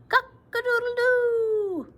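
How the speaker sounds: high, slow, loud crowing; rooster crow. Acted. Rooster calling out in the snow, crowing.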